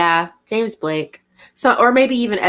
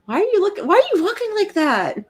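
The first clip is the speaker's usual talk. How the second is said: much higher, playful teasing; 12 semitones higher than usual. Real, not acted. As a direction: tease him in a high sing-song. Teasing boyfriend who just woke.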